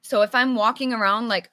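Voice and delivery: ordinary talk, nothing marked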